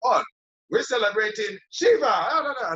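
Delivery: high, bouncy mock cheer; mock festival excitement. Mimicking locals announcing Shiva's festival, high mock cheer. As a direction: toss off the festival name like a hype man.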